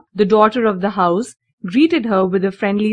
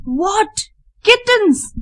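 much higher, frightened shriek; 11 semitones higher, much slower than usual. A narrator's reading. Housekeeper discovers the kittens, a high frightened shriek. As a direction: squeal in terror at the cats. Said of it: "shrieked Miss Rottenmeier".

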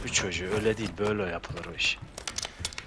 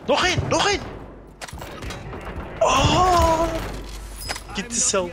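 excited shouting; 14 semitones higher than usual. Real, not acted. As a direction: scream it in the heat of play. Hype moment in game.

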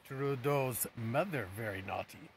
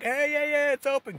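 calling out; 10 dB louder than usual. Retold or imitated. Guy calling out store's open.

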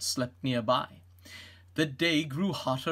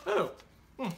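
normal talking voice

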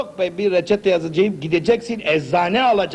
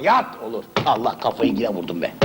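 ordinary talk

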